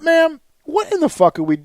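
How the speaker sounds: high-pitched retold outburst; losing his shit. Customer interrupts support agent's endless questions, losing his shit. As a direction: act out losing your temper, pitch jumping up. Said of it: "I lost my shit".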